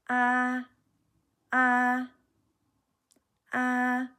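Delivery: high flat "ah"; first tone. Shown as a demonstration. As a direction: hold "ah" high and level, no rise or fall. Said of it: "my sound is not going up or down".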